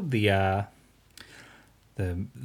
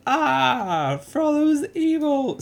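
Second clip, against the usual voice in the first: high, loud, slow outraged cry; hammed-up shocked crowd; 4 dB louder, much slower than usual. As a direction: cry it out in mock horror. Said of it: "suddenly they're like".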